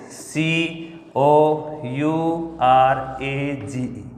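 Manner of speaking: letters drawn out slowly in a chant; slow sing-song spelling. Shown as a demonstration. Teacher spelling courage aloud to students, slow chant.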